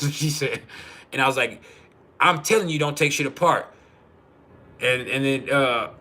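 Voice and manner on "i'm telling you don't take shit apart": raised, louder arguing voice; retold insistent warning. Husband warning wife not to dismantle the starter, insistent. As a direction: press the warning firmly, retelling it.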